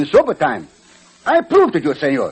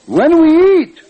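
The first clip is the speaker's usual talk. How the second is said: loud complaint; 11 semitones higher, 6 dB louder, slower than usual. Acted. Pancho complains about food.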